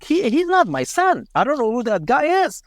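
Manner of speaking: high-pitched insistent protest; retold denial. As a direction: imitate a parent's denial, pitch jumping up. Mother denies the body is son's.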